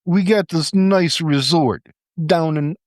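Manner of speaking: put-on hammy German accent slipping into New York; high, slow mock German accent. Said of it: "I was gonna do a German accent, and it started to sound New York".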